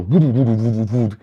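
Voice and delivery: loud, rolling vocal flutter; rapid mouthed rumble noise; imitated sound effect. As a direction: burble the effect out in a run. Imitates effect across the panel.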